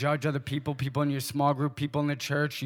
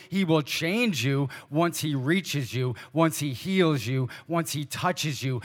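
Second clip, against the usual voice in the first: slow, deliberate promise; slower than usual. Acted.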